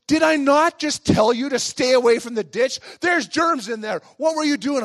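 high, loud indignant questioning; scolding grandma. Retold or imitated. Grandma confronts the boy about germs, mock scolding. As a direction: tell off a disobedient child, in imitation.

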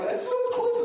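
high, whiny imitation of Missy shivering; mimicking a cold coworker. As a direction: squeak it out like she's freezing. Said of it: "And she's like".

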